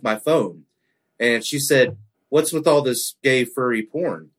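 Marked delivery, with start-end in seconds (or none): none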